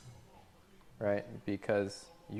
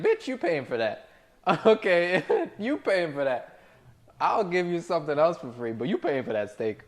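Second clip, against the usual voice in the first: louder, higher sassy clapback; defiant retort; 6 semitones higher, 5 dB louder than usual. Retold or imitated. Server to rude customer, mock sassy clapback. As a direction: put her in her place.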